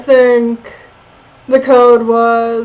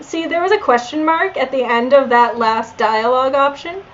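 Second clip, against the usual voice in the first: ordinary talk, nothing marked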